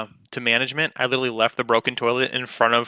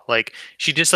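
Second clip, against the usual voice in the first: ordinary talk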